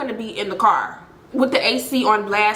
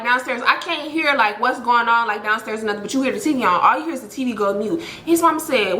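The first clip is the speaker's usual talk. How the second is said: usual speaking voice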